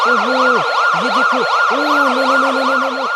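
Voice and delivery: very high, loud shrieks of no; panicked shriek. Acted. Mother sees the tiger around the baby, high panicked shrieks. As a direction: scream no at the tiger.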